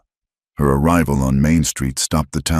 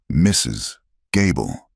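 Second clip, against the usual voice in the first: usual speaking voice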